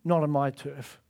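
lion's low territorial warning; low quiet warning. Acted. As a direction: quietly warn the enemy off. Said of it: "It's saying to the enemy".

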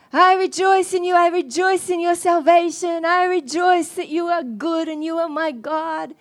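praising God loud and high in the lobby; loud rejoicing. Retold or imitated. He rejoices in earnest.

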